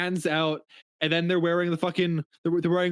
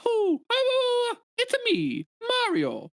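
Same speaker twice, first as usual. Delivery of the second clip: very high falsetto cartoon plumber; Mario. Shown as a demonstration. Showing off a killer impression to friends, Mario voice. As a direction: squeal the catchphrases like the game character. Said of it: "I can do a killer Mario impression".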